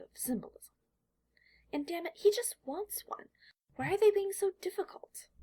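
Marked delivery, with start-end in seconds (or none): 1.7-5.3 higher, louder exasperated whine; a child fed up with his parents; pitched up and pushed out in frustration; frustrated outburst. A narrator's reading. Boy's inner frustration, narrated.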